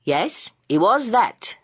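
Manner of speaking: slow, drawn-out agreement; Bunting slowly agreeing with Joe, slow. A narrator's reading. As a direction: take your time over each word. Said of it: "said Bunting slowly".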